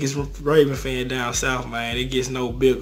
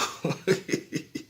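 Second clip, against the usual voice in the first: soft breathy laugh; 3 semitones higher, 6 dB quieter than usual. Real, not acted. Laughs after his own boast.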